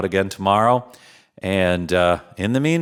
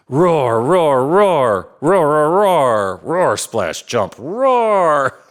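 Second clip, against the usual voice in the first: dinosaur roar; 3 semitones higher, 3 dB louder, much slower than usual. Acted. Dinosaur roaring in puddles.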